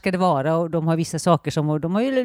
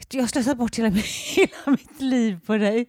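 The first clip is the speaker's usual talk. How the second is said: giggling through the line; 4 semitones higher than usual. Retold or imitated. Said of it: "Så".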